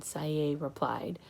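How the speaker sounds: usual speaking voice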